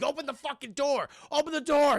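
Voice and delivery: imitated urgent shouting; acted frantic demand. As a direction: yell the order like a panicked hostage. Acting hostage yelling to open door.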